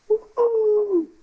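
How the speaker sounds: long, high-pitched ooh; mock-awkward high hoot. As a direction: let out a drawn-out high ooh. Preacher's acted reaction to the exchange.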